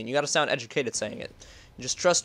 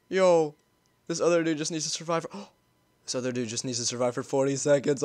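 ordinary delivery

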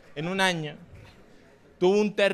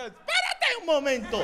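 indignant call-out; 7 semitones higher than usual. Retold or imitated. Comic to walkouts insulting him, high indignant call. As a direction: halt them with a high-pitched protest. Comic halts offended audience.

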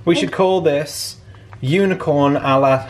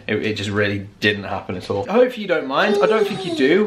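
usual speaking voice

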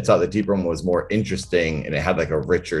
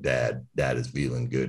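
deep [name] style; 6 semitones lower, 8 dB quieter than usual. Shown as a demonstration. Showing friend the lower singing register, low and soft. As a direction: lower and softer, gravel-free deep croon. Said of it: "singing like this".

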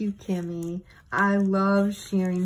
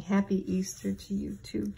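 ordinary delivery

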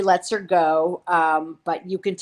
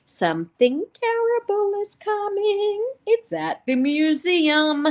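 high, slow, eerie mock prophecy; Drusilla having a vision; 14 semitones higher, slower than usual. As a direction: stretch it out like an otherworldly seer. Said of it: "she's having one of her visions".

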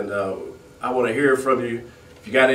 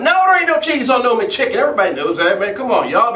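exasperated outburst; 8 semitones higher, 3 dB louder than usual. Real, not acted. Exasperated rant at customer.

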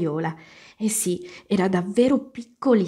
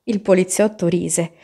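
ordinary delivery, nothing marked

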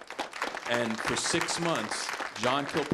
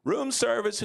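higher, louder call through a door; calling room service; 8 semitones higher, 4 dB louder than usual. Retold or imitated. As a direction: sing out like a hotel waiter.

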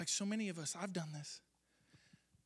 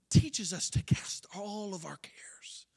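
whispered emphasis; 8 dB louder, slower than usual. Acted. Emphatic sermon point.

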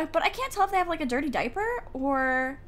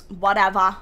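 "whatever" tossed out louder, falling off; louder brush-off "whatever"; dismissive; 3 semitones lower, 9 dB louder than usual. Real, not acted. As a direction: shrug it off out loud.

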